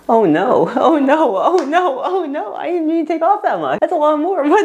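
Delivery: high, rising wail of dismay; panicked dismay. Real, not acted. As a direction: let the panic climb with each repeat. Dismay at cutting too much.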